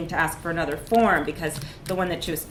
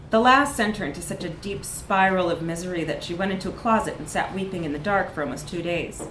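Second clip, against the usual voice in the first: usual speaking voice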